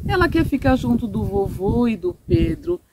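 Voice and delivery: plain reading voice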